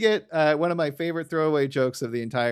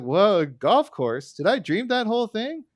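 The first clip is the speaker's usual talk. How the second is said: mock Homer voice; 7 semitones higher than usual. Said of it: "Homer's like".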